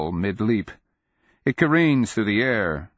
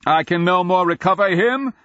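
high, loud declamation; ringing, full-voiced fury; enraged shout; 13 semitones higher, 7 dB louder than usual. A narrator's reading. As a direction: shout it down, drawing out the ending. Nonman shouts down at the wizard.

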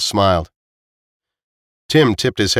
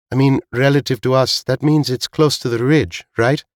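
higher voice for the woman character; Veek; 5 semitones higher than usual. A narrator's reading. Veek checking the ridge's distance with Tim, higher character voice. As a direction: pitch it up, ending on a questioning rise.

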